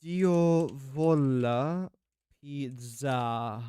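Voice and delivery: put-on very slow, drawn-out Italian accent; exaggerated, lingering Italian pronunciation. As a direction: stretch each syllable in a put-on Italian accent. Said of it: "trying to pronounce it in an Italian accent".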